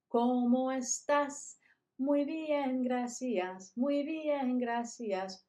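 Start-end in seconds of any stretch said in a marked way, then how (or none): 0.1-1.6 put-on drawn out and lifted for kids to copy; teacher modeling; slow model question. Shown as a demonstration. Teacher asking the class slowly, drawn out. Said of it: "Let's try it again".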